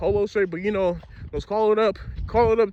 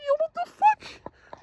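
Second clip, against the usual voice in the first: much higher, slow and softer squeal; squeaky dazed protest; 4 dB quieter, slower than usual. Retold or imitated. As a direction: drag it out in a high daze. Retells being slammed into wall.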